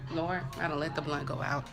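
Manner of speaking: plain reading voice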